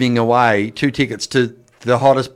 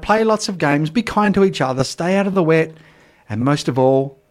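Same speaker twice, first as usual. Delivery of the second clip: podcast sign-off; 7 semitones higher than usual. Acted. Host signs off the podcast.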